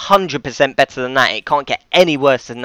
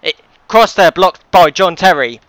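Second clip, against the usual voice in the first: mock commentator echo; 5 semitones higher, 8 dB louder than usual. Echoing the game commentator.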